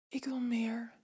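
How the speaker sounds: softest possible whisper. Acted. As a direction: breathe it out barely audible. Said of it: "fluister ik zo zacht als ik kan".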